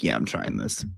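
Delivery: low, soft, sure of itself; quiet deep mock resolve; quoted inner voice. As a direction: drop low and quiet, decided. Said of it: "and I'm like".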